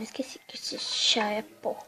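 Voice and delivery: soft whispered aside; whispering. Real, not acted. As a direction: breathe it as a secret. Whispered aside about the tea.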